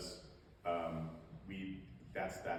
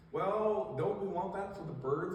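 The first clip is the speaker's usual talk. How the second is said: high, louder put-on questioning voice; mock objector; 10 semitones higher, 5 dB louder, slower than usual. People asking about honeysuckle, high mock protest. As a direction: pipe up like a member of the public.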